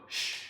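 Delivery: a short correcting shh; shush. Shown as a demonstration. Showing the correction sound, firm shush. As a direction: demo shush to calm a dog. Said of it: "I go".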